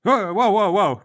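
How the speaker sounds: loud, high startled reaction. Acted. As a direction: react as if suddenly grabbed. Boy startled by sudden grab.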